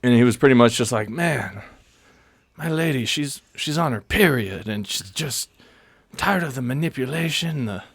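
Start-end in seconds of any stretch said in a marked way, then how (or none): 1.1-7.8 higher, softer throaty imitation of a weary man; hint of a throaty, tired mechanic-shop stranger; throaty raspy man. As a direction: lightly voice a raspy guy complaining about his lady. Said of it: "more throaty, kinda like raspy voice".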